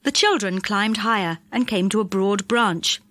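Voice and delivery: usual speaking voice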